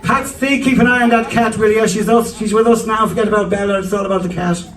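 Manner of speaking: high, calling out to dad; mum calling. Retold or imitated. Mum calls dad about the cat, high and doting. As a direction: call him from the next room. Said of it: "that's Patsy's my dad".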